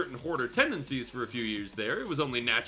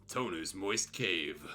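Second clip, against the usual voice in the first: deadpan low drawl; 8 semitones lower, 7 dB quieter, much slower than usual. Acted. Deadpan reread of a funny phrase.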